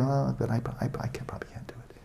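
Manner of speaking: quick unvoiced whisper of doubt; timid whisper. A student dismissing any idea, in a quick timid whisper. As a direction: hurry the excuse out in a whisper.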